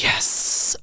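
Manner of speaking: a secret little yes in a whisper. Retold or imitated. To the curious teen, a soft whispered yes. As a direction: breathe the yes like a win. Excited aside.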